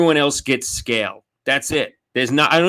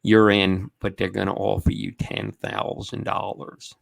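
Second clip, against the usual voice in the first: manager on the phone; 4 semitones lower, 5 dB quieter than usual. Retold or imitated. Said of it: "Oh, yeah".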